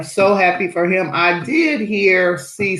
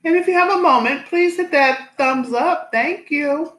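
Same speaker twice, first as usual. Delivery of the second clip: upbeat channel outro; 10 semitones higher than usual. Acted. Outro-style request to viewers.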